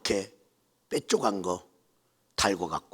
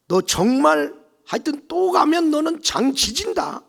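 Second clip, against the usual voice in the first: much higher, louder furious warning; shouted threat; 12 semitones higher, 10 dB louder than usual. Retold or imitated. Mother-in-law threatening to burn her, furiously. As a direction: threaten her one last time.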